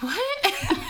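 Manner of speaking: high squeaky "What?" through a laugh; incredulous, half laughing; laughing, can't believe it; laughing disbelief. Retold or imitated. Repeats her shocked reaction.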